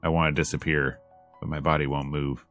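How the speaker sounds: ordinary talk, nothing marked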